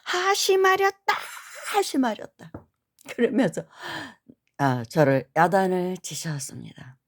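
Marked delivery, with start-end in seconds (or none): 0.0-2.5 much higher commanding cry, then falling; stern shout. Retold or imitated. Monk pounding the moktak at her, commanding shout. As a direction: strike each word like the moktak mallet. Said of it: "목탁이 갈라질 것 같은 그 정도의 힘으로".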